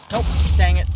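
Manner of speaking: sudden loud yelp of frustration; loud "dang it" as things go wrong; startled outburst. Real, not acted. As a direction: blurt it out loud, caught off guard. Creeper blows up nearby.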